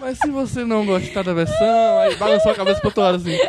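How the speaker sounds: coy, slow teasing voice with a laugh; mock flirty charm. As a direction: flirt it out playfully, drawing the words. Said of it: "jogou um charme assim".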